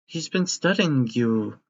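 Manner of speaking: bright, high, sweet robot nurse; kindly robot caretaker. Acted. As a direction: play a doting robot nanny.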